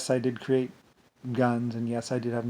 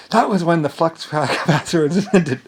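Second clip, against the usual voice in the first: louder, giggling through it; laughing; 6 semitones higher, 10 dB louder than usual. Real, not acted. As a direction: break into laughter mid-sentence. Laughs at own joke.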